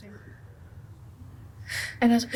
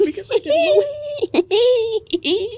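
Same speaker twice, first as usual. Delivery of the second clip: laughing; 14 semitones higher, 7 dB louder, much slower than usual. Real, not acted. Laughing while recalling being stuck.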